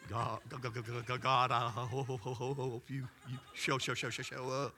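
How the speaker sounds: rapidly repeated, stuttering plea; panicked inner prayer. Retold or imitated. Pastor silently begging God to show up, stammering. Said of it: "inside me was like".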